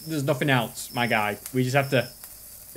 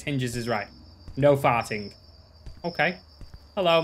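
usual speaking voice

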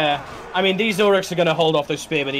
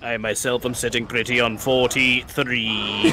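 lower, slow gruff dwarf voice; Gimli; 6 semitones lower, 3 dB quieter, slower than usual. Pretend. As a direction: play a stout dwarf, slowly. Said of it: "You have to do a Gimli impersonation".

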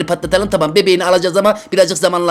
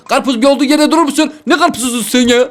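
panicked; 6 semitones higher than usual. Real, not acted. Panicking at runaway watermelon patient.

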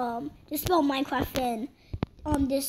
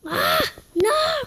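sudden high yelp of dismay; shriek; 13 semitones higher, 4 dB louder than usual. Real, not acted. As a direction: cry out as the run is lost. Losing in game, cries out.

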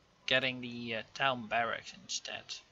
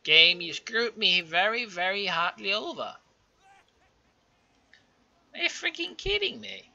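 loud, high complaint at the game; angry rant; 6 semitones higher, 6 dB louder than usual. Real, not acted. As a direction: vent real anger at the game. Cursing the game.

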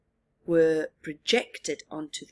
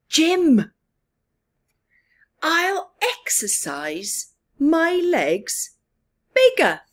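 higher, louder, slow resolve; determined Bob; 9 semitones higher, 8 dB louder, slower than usual. Acted. Bob telling Jim he will exercise, loud eager resolve. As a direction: declare the plan with eager determination. Bob announces his plan.

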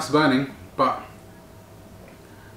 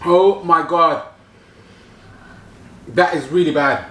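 mouth burning from spicy food; 5 semitones higher, 5 dB louder, slower than usual. Real, not acted. Reacting to spicy wing.

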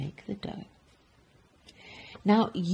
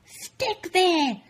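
high, frustrated yell; angry yell; 12 semitones higher, 4 dB louder than usual. Acted. Baker flinging dough at the ceiling, angry yell. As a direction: yell it in a fit of anger. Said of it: "he yelled at the dough".

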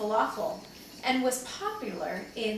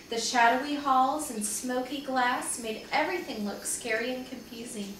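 usual speaking voice

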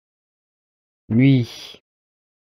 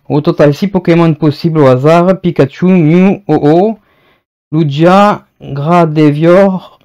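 louder, projected presenter read; product read-aloud; 3 semitones higher, 6 dB louder than usual. Acted. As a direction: read the blurb out clearly to viewers. Reads the product description aloud.

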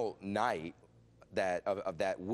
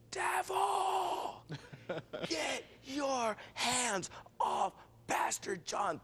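commanding rebuke; much slower than usual. Retold or imitated. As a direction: cast the devil out at full voice. Girl rebukes devil in cave.

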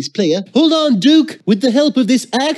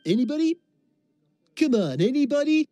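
quiet mumbling; 10 dB quieter than usual. Acted. Bored quiet game.

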